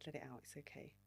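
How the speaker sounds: calm her down gently; soothing hush. Retold or imitated. Date comforting her as she cries, soft and soothing. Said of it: "he goes".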